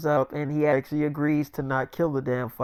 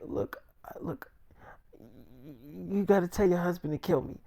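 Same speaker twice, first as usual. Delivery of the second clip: faint, halting near-whisper; feeble, hushed plea; imitated weakness; 10 dB quieter than usual. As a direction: struggle to get the words out softly. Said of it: "'cause he was still kinda weak".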